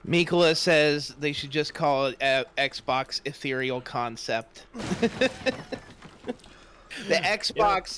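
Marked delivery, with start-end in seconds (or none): 4.7-5.8 amused laugh. Real, not acted. Laughing at a joke.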